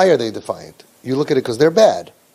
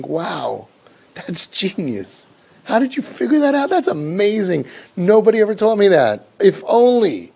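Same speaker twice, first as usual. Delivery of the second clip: put-on sarcastic, gushing and higher, the child overdoing it; mock gushing gratitude; 5 semitones higher than usual. A child sarcastically thanking the parent for obvious advice, higher and gushing. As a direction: gush over the obvious advice with heavy irony.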